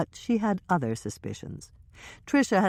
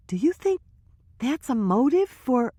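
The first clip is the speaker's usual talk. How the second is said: put-on higher young woman's voice, hushed gossip; Trisha's voice; 10 semitones higher, 3 dB louder than usual. Trisha confiding to Hannah and Michelle, higher nervous voice. Trisha, scared, can't finish.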